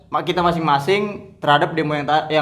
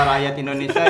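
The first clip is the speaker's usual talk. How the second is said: ordinary talk, nothing marked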